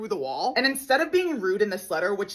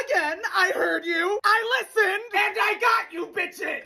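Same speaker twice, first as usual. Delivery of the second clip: emotional shout; 8 semitones higher than usual. Acted. Fans asked for scream.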